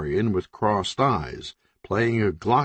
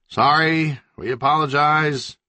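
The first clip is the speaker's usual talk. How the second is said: higher, louder apology; yelled apology; 6 semitones higher, 3 dB louder than usual. Acted. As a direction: call the sorry through the wall. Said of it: "the leader yelled through the wall".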